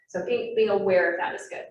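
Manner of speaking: ordinary talk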